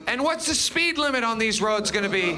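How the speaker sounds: ordinary talk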